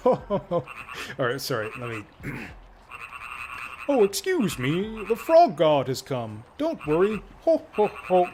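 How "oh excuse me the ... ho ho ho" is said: higher, slow mock frog puppet voice; a hammed-up little frog puppet greeting; Kermit the Frog. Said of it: "That's my Kermit the Frog impression".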